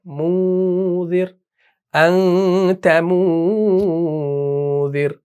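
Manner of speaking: very slow, "अंत मूजिर" with long nasal hums; drawn-out recitation of "मूजिर", n hidden; silenced noon. Shown as a demonstration. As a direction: stretch "मू" and "अं" through the nose, no hard n. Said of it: "इस एन को आपने silent करना है".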